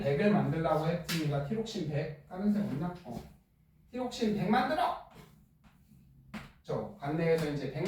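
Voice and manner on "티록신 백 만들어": high, sharp command; playful mock command from the liver; barked order. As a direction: bark the order like a boss. Teacher voices the liver ordering.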